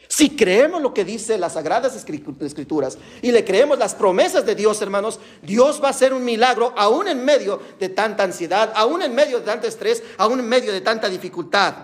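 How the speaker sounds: high, insistent sermon delivery; fervent preaching. Real, not acted. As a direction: preach it with rising urgency, phrase after phrase. Preaches to congregation.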